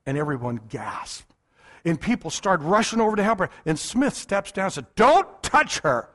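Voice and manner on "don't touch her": slow, higher, louder order; sharp command. Retold or imitated. Wigglesworth ordering the men not to touch her, slow and loud. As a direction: stop the helpers cold.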